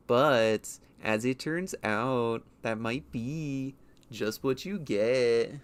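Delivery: slow, loud YouTube narrator read; YouTuber voice. Shown as a demonstration. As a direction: drag it out like a clickbait narrator. YouTuber voice bit on meme.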